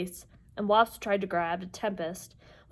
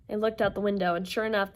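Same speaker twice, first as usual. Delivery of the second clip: ordinary delivery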